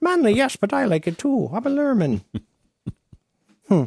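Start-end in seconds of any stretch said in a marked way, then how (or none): 0.0-2.2 very high, squeaky mock Glaswegian; Glaswegian Lurmen voice. Co-host voices a Lurmen in a squeaky Glaswegian accent. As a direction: squeal it like a tiny Glaswegian creature. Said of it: "this little weird Glaswegian accent".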